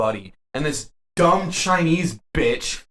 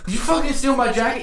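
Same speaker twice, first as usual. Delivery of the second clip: retold yell, pitched well up; mild mock shout about the jacket; mock confrontation; 10 semitones higher than usual. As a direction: imitate an angry stranger accusing you. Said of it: "He's like".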